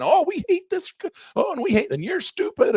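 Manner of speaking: a childish online bickerer, mocked; mock forum troll. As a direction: sneer it like a petty commenter. Mocking squabbling forum users.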